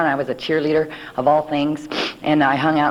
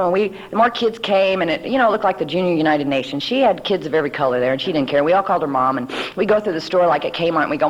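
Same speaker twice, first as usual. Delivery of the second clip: normal talking voice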